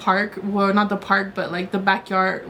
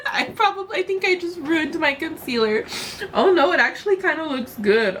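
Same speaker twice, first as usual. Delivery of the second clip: high teary laugh; laughing through tears; 8 semitones higher than usual. Real, not acted. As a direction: laugh it off while still crying. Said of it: "I need to stop crying".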